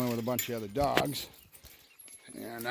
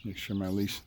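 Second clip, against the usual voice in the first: ordinary delivery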